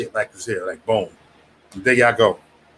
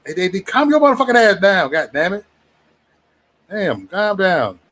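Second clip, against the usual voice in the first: mock calming down; 6 semitones higher than usual. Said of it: "I like to say".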